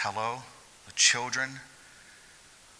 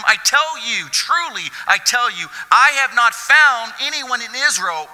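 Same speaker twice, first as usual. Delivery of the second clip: loud, high, slow proclamation of Jesus; Jesus marvelling at the centurion's faith; Jesus proclaiming; 14 semitones higher, 11 dB louder, slower than usual. Acted. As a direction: declare it like Jesus astonished. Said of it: "and he said to those following him".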